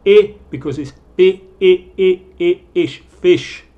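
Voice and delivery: I said loud and high, ish drawn from fish; vowel drill. Shown as a demonstration. Teacher showing why it goes with fish, emphatic. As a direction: pull out the short I. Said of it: "Escucha el sonido".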